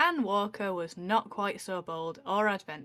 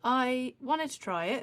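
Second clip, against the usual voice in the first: usual speaking voice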